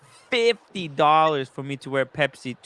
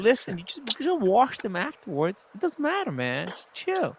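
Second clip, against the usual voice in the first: high, breezy brush-off; dismissive; 7 semitones higher, 3 dB quieter than usual. Retold or imitated. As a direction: wave the complaint away. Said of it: "But I'm gonna just say".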